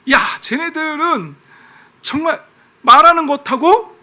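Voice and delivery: high-pitched, drawn-out reproach; God. Acted. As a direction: call out their hypocrisy, slowly rising in volume. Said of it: "이스라엘 백성들을 꼬집는 거예요". (높은 언성으로 꼬집어 나무라는 하나님)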